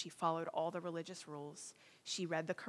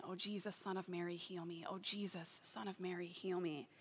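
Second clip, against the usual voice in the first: softer murmured repeating prayer; soft night prayer; 8 dB quieter than usual. Retold or imitated. Woman praying alone at night, soft murmur. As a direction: murmur it softly, alone at three in the morning. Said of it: "she starts praying".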